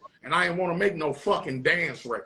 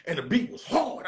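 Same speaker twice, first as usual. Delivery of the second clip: ordinary talk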